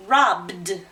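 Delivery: "Robbed" with a clearly released voiced d; voiced d ending. Shown as a demonstration. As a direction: demonstrate "Robbed" as one syllable, loud, ending in bd. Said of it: "Hear that d?".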